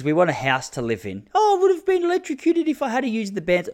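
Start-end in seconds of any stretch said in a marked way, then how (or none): 1.3-3.7 high, whining tradie excuse; mock whiny workman. Host mimics the builder's excuse in a mocking, high whine.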